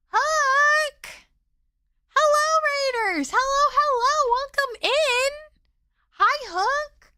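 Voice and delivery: high bubbly streamer greeting; cute anime streamer. Acted. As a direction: chirp a bright welcome to the raiders.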